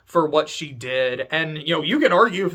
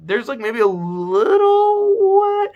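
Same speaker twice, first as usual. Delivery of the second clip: higher, louder and slow, voice cracking into a cry; real tears; 11 semitones higher, 7 dB louder, slower than usual. Real, not acted. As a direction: let the real tears break the line. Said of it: "I'm starting to cry almost a little bit".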